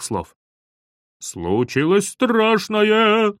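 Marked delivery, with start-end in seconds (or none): 1.2-3.3 high, drawn-out mocking sing-out; stretched, high call from the bathroom; loud echoing call. A narrator's reading. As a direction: boom it back slowly like an echo. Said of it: "Громкое эхо донеслось из ванной".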